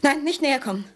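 high, alarmed warning; frightened cry. Acted. Startled woman warns the intruder off, frightened. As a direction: keep him back, startled and high.

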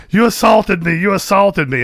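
high, loud mocking shriek of the accuser; imitated scream. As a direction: shriek it like the man in the video.

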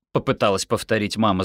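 ordinary delivery

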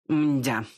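a sighed, resigned mdya; sighing mdya. Acted. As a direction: sigh it out, disappointed. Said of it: "вздохнул шепелявый". (вздох «мдя»)

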